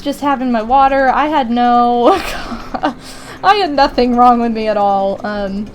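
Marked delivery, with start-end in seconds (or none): 2.1-3.4 breathy, voiceless chuckle; real laugh. Real, not acted. Storyteller amused by her own tale, real laugh. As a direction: crack up mid-story.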